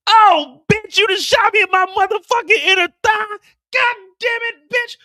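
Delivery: very high loud pained shriek; high loud hammed-up pain; pained yelling. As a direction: scream after being shot. Hitman shot in thigh.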